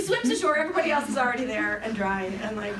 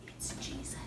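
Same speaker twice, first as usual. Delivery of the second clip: hushed whisper of recognition; awed whisper; 14 dB quieter than usual. Retold or imitated. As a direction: whisper it in awe. John recognizing Jesus from boat.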